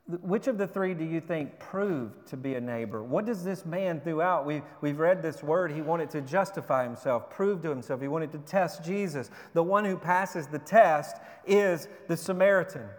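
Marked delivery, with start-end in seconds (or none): none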